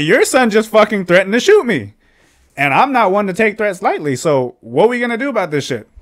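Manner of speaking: loud, high-pitched indignant outburst; heated retold confrontation. Telling the dad his son threatened him, heated retold outburst. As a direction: re-enact confronting someone, voice way up.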